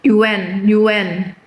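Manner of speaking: Yuan said as a clipped yuen; Chinese-style reading. Shown as a demonstration. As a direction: say Yuan as yuen, falling and short. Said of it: "Nih ya".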